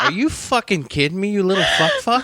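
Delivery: mock outrage. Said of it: "I was like".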